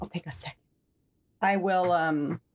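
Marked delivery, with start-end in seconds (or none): 0.0-0.6 whispering. Real, not acted. Whispered reply.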